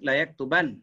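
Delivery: "yaktuban" closing on one light n; light nun. Shown as a demonstration. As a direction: end "yaktuban" at the n without adding a. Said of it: "Kalau yang nipis". (sebut "yaktuban" dengan nun nipis)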